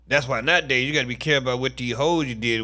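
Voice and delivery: ordinary talk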